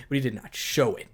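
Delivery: usual speaking voice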